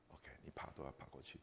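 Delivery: breathless whisper to himself. Real, not acted. Widower echoes his late wife's charge, whispered. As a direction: let it fall to a bare whisper.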